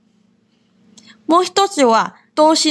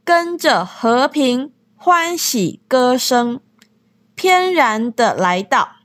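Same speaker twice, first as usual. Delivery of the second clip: slow lyric reading; slower than usual. Shown as a demonstration. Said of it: "次は、".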